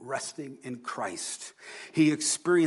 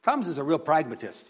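usual speaking voice

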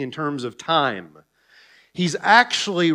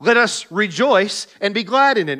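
proclaiming; 5 semitones higher, 4 dB louder than usual. Acted. Preacher proclaiming the verse.

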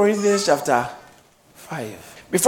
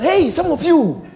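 slow, raised calling-out preacher voice; imitating a preacher; 9 semitones higher, 4 dB louder, slower than usual. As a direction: call out like the pastor.

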